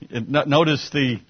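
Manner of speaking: ordinary talk, nothing marked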